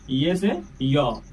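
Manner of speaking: letter name drawn out for pupils; slow teaching drill. Shown as a demonstration. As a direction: say ये से य slowly so the class can follow. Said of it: "teacher drilling letter names". (धीरे-धीरे अक्षर पढ़ाते हुए)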